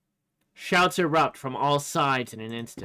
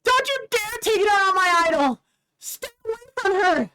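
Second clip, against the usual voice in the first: high, loud, raging and close to tears; furious tearful girl; 6 dB louder, faster than usual. Acted. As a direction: scream at the cruel fans to defend her idol. Reese defends her idol.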